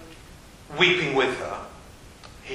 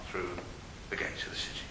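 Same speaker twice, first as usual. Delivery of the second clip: whisper; 9 semitones lower, 15 dB quieter, much faster than usual. A narrator's reading. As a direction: breathe the words out, much softer. Hushed storytelling climax.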